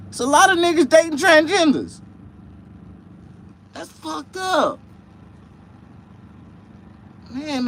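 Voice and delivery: squeaky high, loud, worked-up character voice; high excited persona. Acted.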